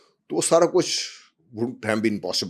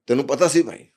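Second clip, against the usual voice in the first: raised, quick mock scolding; louder, higher reproach, acted out; retold scolding; 6 semitones higher, 7 dB louder, faster than usual. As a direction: imitate an older friend telling you off. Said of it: "وہی ڈانٹ رہا تھا".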